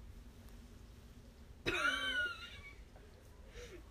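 very high, squealing laugh; long laugh. Real, not acted. Laughing with mask on. (langes, hohes Lachen)

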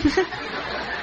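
short voiceless laugh; breathy laugh. Real, not acted. Laughs at the story. (轻声笑出来)

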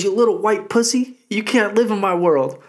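high-pitched Tyson imitation; mock Tyson taunt. Imitating Tyson taunting a heckler, high and squeaky. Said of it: "He goes".

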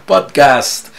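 loud, announcer-style welcome; podcast intro. Acted. Host welcoming listeners to La Bala Fría, announcer voice. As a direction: open the show like a host.

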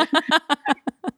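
high giggling laugh; real laugh. Real, not acted. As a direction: burst into giggles at your own story. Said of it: "I started to laugh".

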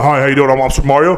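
put-on low, plain American Super Mario without Italian accent; deadpan Mario with no Italian accent. Friend describing the movie's Mario, no Italian accent. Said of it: "didn't have an Italian accent".

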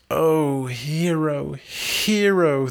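high, loud longing call across the water; a lover crying her name aloud. A narrator's reading. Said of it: "thus he cried full oft".

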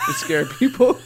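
laughing loudly while saying it. Real, not acted. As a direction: burst out laughing mid-line. Excited hiding plan.

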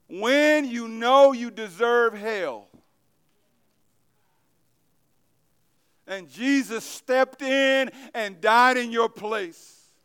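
loud, slow, pounding sermon cadence; preaching at full force. Acted. Preacher urges the church to see God's mercy, full-force preaching. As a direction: drive each phrase home to the congregation.

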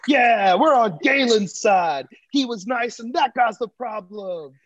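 high, slow crowd cheering with a laugh; mock crowd. Crowd siding with Galen, high and slowed. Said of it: "the whole crowd was like".

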